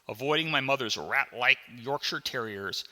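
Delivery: plain reading voice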